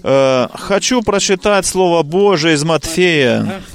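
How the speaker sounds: ordinary talk, nothing marked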